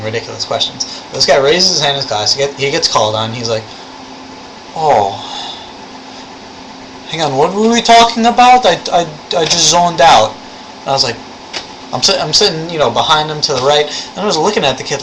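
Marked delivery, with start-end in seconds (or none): 4.7-10.3 mock kid, pitched high; put-on higher voice, playing him zoned out; clueless classmate. Imitating the classmate who got called on, mock clueless. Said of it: "There is this Russian kid in our class".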